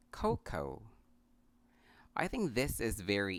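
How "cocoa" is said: "cocoa" as KOH-koh; two syllables in "cocoa", both oh; correct pronunciation. Shown as a demonstration. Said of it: "this word is pronounced".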